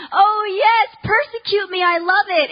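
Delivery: high, loud, hammed-up cheer; overdone mock delight, high and loud; mock eager martyr. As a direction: sarcastically gush about being persecuted. Mocking her romantic ideal.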